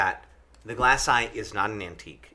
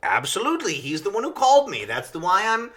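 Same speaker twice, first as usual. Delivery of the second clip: bright, higher, louder eager salesman; eager showman voice; 4 semitones higher, 4 dB louder than usual. Retold or imitated. Friend voicing a boat owner, proud, higher and louder. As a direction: pitch the display like a proud showman. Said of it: "And he's like".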